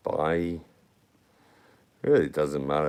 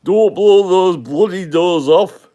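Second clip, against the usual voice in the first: much higher, louder, slow London cockney growl; Michael Caine impression; 11 semitones higher, 10 dB louder than usual. Streamer showing off his Michael Caine for viewers, loud cockney. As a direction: belt it out as a Cockney film tough guy. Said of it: "my impressions were like Michael Caine".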